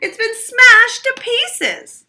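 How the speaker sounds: put-on very high, loud, slow child's lament; wailing. A narrator's reading. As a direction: drag out the outrage like a small boy. Child lamenting broken chair.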